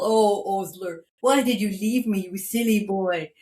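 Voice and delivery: dad tenderly scolding the runaway dog; doting on dog. Retold or imitated. Dad fussing over the dog.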